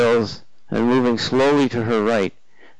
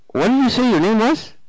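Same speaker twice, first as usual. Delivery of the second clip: wary woman; 11 semitones higher, 4 dB louder than usual. Acted. Flora asking, far timbre.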